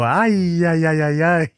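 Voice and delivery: put-on high, loud singsong "ay" of an old umpire; umpire strikeout call. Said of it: "that was his strikeout".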